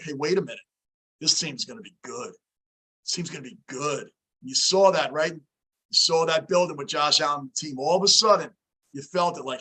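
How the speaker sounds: usual speaking voice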